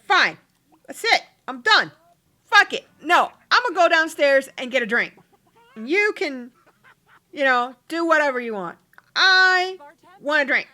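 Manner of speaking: hammed-up fury, done with it all; mock angry outburst. Girlfriend storming off to get a drink, mock furious.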